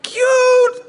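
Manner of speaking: very high loud squeal; squealing cute. Real, not acted. Pastor describes swaddled baby, very high squeal.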